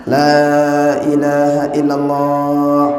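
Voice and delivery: la ilaha illallah drawn out slowly, each syllable spaced; slow and careful. Shown as a demonstration. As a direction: recite it gently and unhurried, so the dying can follow. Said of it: "Jadi, cara kita pelan-pelan".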